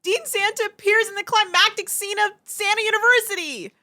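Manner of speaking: high, loud melodramatic wail; over-the-top high-pitched dismay; horrified outcry. Acted. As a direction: cry it out high in theatrical horror. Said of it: "Gal Santa looks on in horror".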